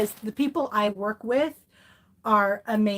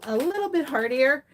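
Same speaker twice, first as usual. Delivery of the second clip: normal talking voice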